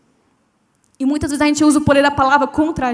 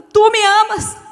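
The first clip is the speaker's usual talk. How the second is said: dramatic plea; 6 semitones higher, 6 dB louder, much slower than usual. Retold or imitated.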